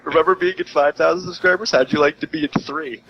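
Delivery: mock taunt. Mock taunt at subscriber count.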